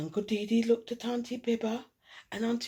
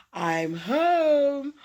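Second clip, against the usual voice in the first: loud, high call from the doorway; 6 semitones higher, 8 dB louder than usual. A narrator's reading. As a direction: announce yourself as you walk in. Someone arrives at the door.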